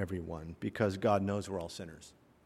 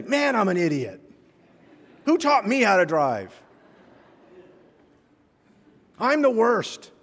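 higher, louder, hammed-up groan at yourself; mock self-scolding; 9 semitones higher, 7 dB louder than usual. Preacher pretending to scold himself after bad driving, hammed-up. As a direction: play it as an exaggerated self-rebuke. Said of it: "we don't say".

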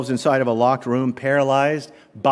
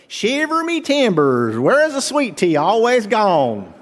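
higher, slow mock Southern pirate drawl; Southern Blackbeard; 7 semitones higher than usual. Preacher imagines Blackbeard speaking like Bill, playful Southern pirate. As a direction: Blackbeard asking for sweet tea, lightly hammed. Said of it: "That's how Bill would say it".

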